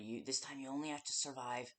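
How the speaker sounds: normal talking voice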